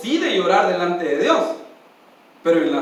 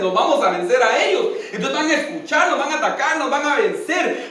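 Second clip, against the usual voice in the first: normal talking voice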